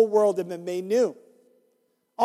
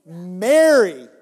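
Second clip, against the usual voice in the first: louder, tender call; calling her name; 7 dB louder than usual. Acted. Jesus calls her name.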